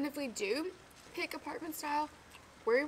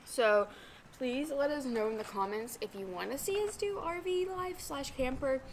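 usual speaking voice

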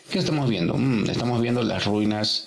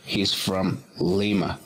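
slow model reading; slower than usual. Shown as a demonstration. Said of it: "model sentence for students".

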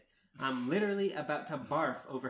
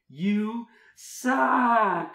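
very high, very loud yell; explosive drawn-out put-down; shouted insult; 8 semitones higher, 14 dB louder than usual. Acted. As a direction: belt it out, dragging the last word. Climax of narrator's insult rant.

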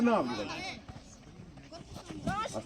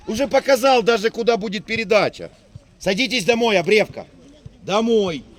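loud frustrated coach; yelling across the field; 8 dB louder than usual. Real, not acted. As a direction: shout it across the pitch. Coach shouting at player.

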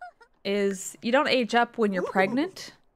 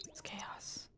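softer whispered comment; whispered aside; 21 dB quieter than usual. Real, not acted. Streamer whispering about the chaos in her game. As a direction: breathe it, almost silent.

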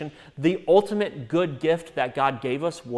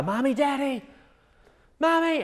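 put-on child crying out for mommy, very high; a little girl calling her parents at night, high; high-pitched child calling; 12 semitones higher, 3 dB louder than usual. Daughter calling parents at night, high child's cry. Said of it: "she'll get real kind of high-pitched".

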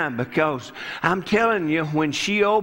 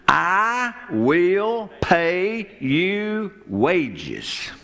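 slow regal promise; much slower than usual. Retold or imitated. Pharaoh's daughter offers wages.

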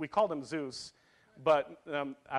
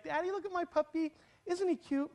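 imitating little girl; 10 semitones higher than usual. Little girl to her daddy on a puppy walk, imitated high child voice. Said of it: "and she'll be like".

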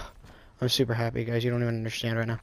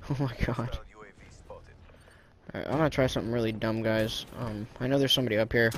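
normal talking voice

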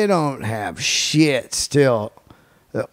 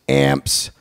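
normal talking voice

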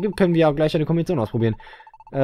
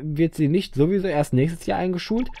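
ordinary talk, nothing marked

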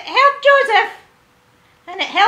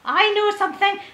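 ordinary delivery

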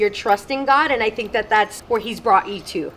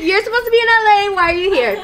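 high, loud, rushed questioning; astonished; 9 semitones higher, 5 dB louder, much faster than usual. Retold or imitated. Questioning the friend who should be in L A, astonished. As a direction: fire off baffled questions, laughter breaking in. Retelling surprise at friend's arrival.

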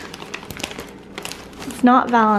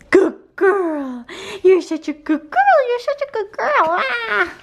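pitched-up sing-song praise for the dog; high dog praise; 9 semitones higher than usual. Real, not acted. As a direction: coo high and bright at a good dog.

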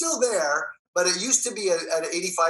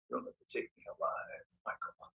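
breathy hushed threat, much lower and softer; intense whisper; 12 dB quieter than usual. Retold or imitated. Hero growling a threat at his foe, hushed. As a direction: mutter the threat barely audible. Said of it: "I'd be like".

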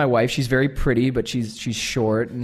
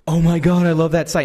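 loud, higher excited greeting; gushing fan; 4 semitones higher, 5 dB louder than usual. Retold or imitated. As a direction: gush loudly at someone you admire.

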